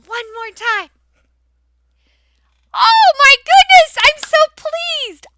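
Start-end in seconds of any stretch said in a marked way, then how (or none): 2.7-5.3 much higher, louder, slow, gushing; gushing delight. Shown as a demonstration. Teacher gushing over the class's answer, delighted. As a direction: overflow with pride.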